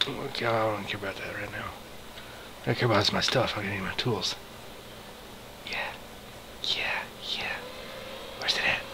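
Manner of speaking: quiet under-breath talk; hushed. Real, not acted. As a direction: keep it down, barely voiced. Creeping quietly near danger.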